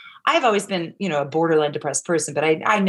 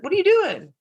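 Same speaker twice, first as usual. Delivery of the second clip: high mock clerk; 12 semitones higher than usual. Clerk's question imitated.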